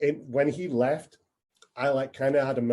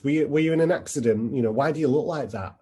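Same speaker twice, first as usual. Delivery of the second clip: louder and higher, pressing on with the question; a tipsy punter who won't let it drop, quickening; pushy loud bloke; 4 semitones higher, 5 dB louder than usual. Retold or imitated. Guy at the bar insists to the server, loud and pushy.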